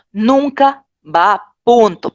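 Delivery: very slow, nunca hit hard; slow emphatic rule. Shown as a demonstration. Teacher driving the rule home to students, very slow and emphatic. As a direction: drag out nunca va punto word by word. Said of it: "que eso les quede claro".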